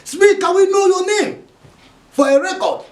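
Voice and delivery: high, loud official demand; demanding police officer. Acted. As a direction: press him for his name. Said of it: "the policeman asked him".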